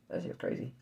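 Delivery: breathy hushed whisper; real shaken whisper. Real, not acted. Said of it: "I had to come home".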